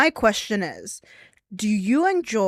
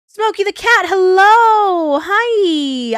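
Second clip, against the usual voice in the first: high, loud cooing at a cat; excited pet greeting; 10 semitones higher, 10 dB louder, much slower than usual. Real, not acted.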